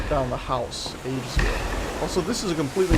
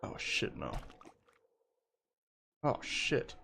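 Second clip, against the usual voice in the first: scared whisper; 3 semitones lower, 9 dB quieter, slower than usual. Real, not acted. Scared in horror game.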